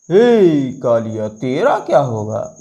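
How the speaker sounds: slow, low menacing Sholay villain; film villain line. Retold or imitated. Teacher hamming the Gabbar line for the class. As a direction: drag it out like the movie villain. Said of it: "अब किसकी बारी आती है?".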